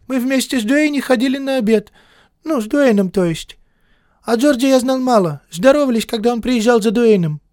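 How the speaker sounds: put-on thin, high lisping boy's voice; a thin lisping teenage boy; slushy lisp. A narrator's reading. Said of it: "с тонким шепелявым голосом".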